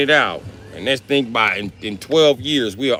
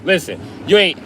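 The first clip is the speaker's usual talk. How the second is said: usual speaking voice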